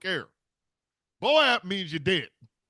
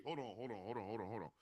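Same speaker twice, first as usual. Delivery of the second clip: much softer, lower stalling; frantic hushed stalling; 8 semitones lower, 18 dB quieter, much faster than usual. Pretend. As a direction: back off under your breath. Crow backs away.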